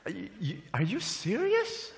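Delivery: high, soft incredulous questioning; baffled waiter. Acted. Waiter scratching his head questions the customer, baffled high voice. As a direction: stammer softly, rising in disbelief. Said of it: "waiter is scratching his head and said".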